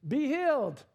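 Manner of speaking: high, loud, hammed-up pronouncement; mock healing command. Surgeon waving over a sick body, in a mock healing command. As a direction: proclaim it like an instant miracle cure.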